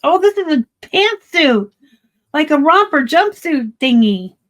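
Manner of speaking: high, loud and thrilled; bursting with delight over a find; excited. Real, not acted. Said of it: "I get excited".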